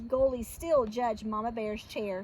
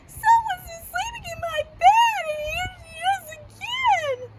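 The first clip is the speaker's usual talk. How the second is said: high, loud tearful wail; Baby Bear; 8 dB louder than usual. Acted. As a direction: wail in terror like a tiny cub. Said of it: "he wailed".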